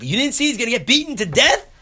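very high, loud disbelief; hammed-up shocked protest; incredulous outcry. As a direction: challenge the rebbe in shrieked astonishment. Seer confronts Reb Melech, incredulous.

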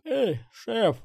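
high yawning call. Acted. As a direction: call out mid-yawn. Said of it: "зевнул Ксенобайт".

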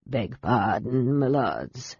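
bow and bob as an ancient seaman; humble old sailor. Acted.

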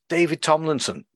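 normal talking voice

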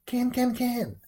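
high, ringing bird call; pheasant cry. A narrator's reading. Pheasant flying over the gate into battle, high bird cry.

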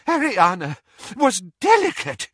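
high, halting desperate plea. A narrator's reading. Doge defending Ariana, desperately. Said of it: "said Doge desperately".

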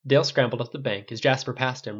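usual speaking voice

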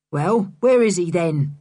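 lightly roughened gruff voice; hoarse voice. Acted. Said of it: "said Mister Stick's hoarse voice".

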